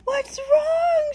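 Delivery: high alarmed gasp and question; gasp, then cry out in alarm; scared. Acted. Mama llama fears for her baby, alarmed. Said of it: "Mama llama looks scared".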